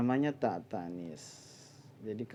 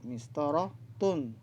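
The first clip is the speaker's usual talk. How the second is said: mistaratun drawn out, tun clearly sounded; mistaratun, each syllable given its time; slow model word; 2 semitones higher, slower than usual. Shown as a demonstration. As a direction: say mistaratun slowly so the ending is heard. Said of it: "tun adalah muannas".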